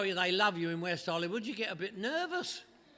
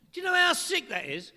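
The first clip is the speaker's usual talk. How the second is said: emphatic sick question; 9 semitones higher, 8 dB louder than usual. Real, not acted. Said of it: "That's really sick".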